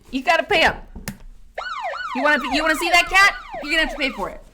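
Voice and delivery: high, loud hammed-up hustler voice, punching pay; mock greedy demand. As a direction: push the joke harder. Teasing chat about the cat.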